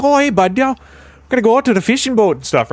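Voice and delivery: high-pitched mock Newfoundland accent; strong Newfie accent. Canadian explaining Newfoundlanders to chat, in a strong Newfie accent. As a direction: exaggerate a Newfie fisherman's lilt. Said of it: "a really strong Newfie accent".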